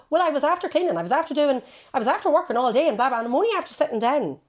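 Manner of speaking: defending yourself to a critical partner, fast and loud; defensive. Retold or imitated. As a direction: rattle off excuses, fast and loud.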